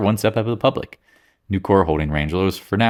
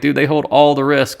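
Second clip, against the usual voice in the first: emphatic retort; 7 semitones higher, 5 dB louder than usual. Retold or imitated. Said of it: "I'm like".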